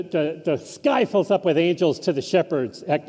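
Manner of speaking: normal talking voice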